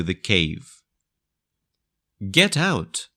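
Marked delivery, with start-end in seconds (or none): 2.3-3.1 pitched-up shout of Get out, falling hard; fisherman's cry. A narrator's reading. Fisherman driving the hungry dog from the cave, crying out higher. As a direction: chase the dog off with a sharp cry. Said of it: "cried the fisherman".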